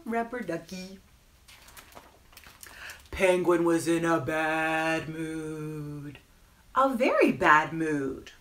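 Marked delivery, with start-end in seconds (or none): 3.2-8.3 slow, stretched-out storytelling with punched key words; children's story narration. A narrator's reading. Storyteller reading about a grumpy penguin, animated narration. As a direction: draw out the phrases for small children. Reads picture book to children.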